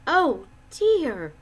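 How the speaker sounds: lightly voiced fretful woman; groaning. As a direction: moan it in mild despair. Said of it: "groaned Missus Dismal".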